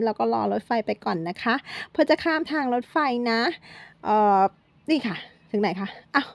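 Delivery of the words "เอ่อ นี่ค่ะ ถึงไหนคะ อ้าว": ordinary talk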